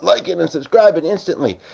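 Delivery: giddy imitation of a viewer; imagined excited viewer. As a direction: gush like a thrilled fan who just found him. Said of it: "you might have been like".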